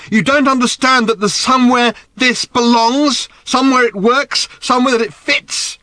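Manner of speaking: put-on high, loud, accusing teen yell; angry. A narrator's reading. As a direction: throw each question at him. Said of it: "her face dark with anger".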